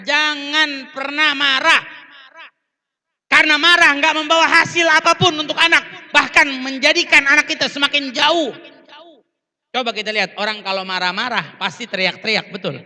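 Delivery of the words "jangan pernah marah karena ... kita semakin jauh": loud, high-pitched exhortation; impassioned sermon at full voice; forceful preaching. Real, not acted. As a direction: drive the warning home to the whole crowd.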